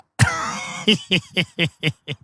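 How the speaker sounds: long wheezy fit of laughing; helpless laughter. Real, not acted. Comic remembering his late friend's antics, real helpless laughter. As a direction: crack up before you can tell it.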